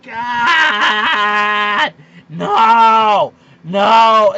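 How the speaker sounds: loud drawn-out groaning no; exasperated shout. Retold or imitated. Every time LeVar Ball talks, a loud exasperated shout. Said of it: "I feel like going".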